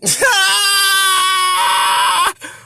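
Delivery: very high, long, loud squeal; sustained high shriek of delight; hyped scream. Real, not acted. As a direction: scream it out at the top of your range. Reacting to the punchline.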